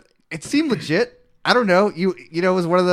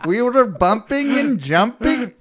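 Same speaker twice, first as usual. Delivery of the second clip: put-on slow, higher Indian accent; hammed-up Indian character accent; mock Indian accent; 6 semitones higher, slower than usual. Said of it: "you read all the Indian characters".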